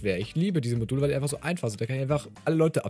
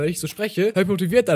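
higher, louder, upbeat delivery; motivated; 5 semitones higher, 5 dB louder than usual. Real, not acted. YouTuber telling viewers he sounds motivated, motivated voice. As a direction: speak up with motivation. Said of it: "heute klinge ich vielleicht etwas motivierter".

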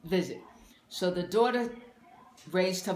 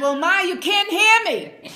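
loud, slow mock retort to a deaf mother; 12 semitones higher, 12 dB louder, slower than usual. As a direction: drag it out, half laughing. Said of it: "And she said".

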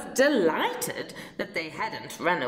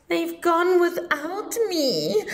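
cried; 9 semitones higher, 5 dB louder than usual. A narrator's reading. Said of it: "And he cried".

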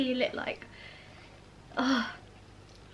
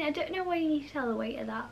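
ordinary talk, nothing marked

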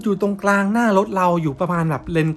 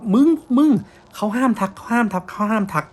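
much higher, urgent scolding hiss; 6 semitones higher, 3 dB louder than usual. Retold or imitated. Friend snapping at the others not to call out the ghost, urgent scolding. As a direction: scold them to stop talking about it. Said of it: "ด่าสวนกลับมาว่า".

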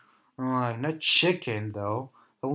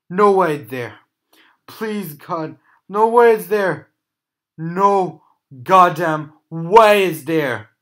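loud frightened disbelief; jump scare laugh; 4 semitones higher, 12 dB louder than usual. Real, not acted. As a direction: shout it, terrified. Terrified by animatronic.

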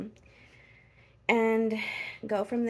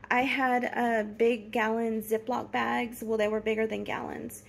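normal talking voice

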